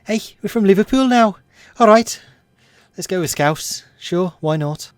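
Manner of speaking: high-pitched, put-on Liverpool accent; lively mock Scouser; Scouse accent. Shown as a demonstration. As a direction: switch into an exaggerated Scouse voice. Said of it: "I could do it in Scouse".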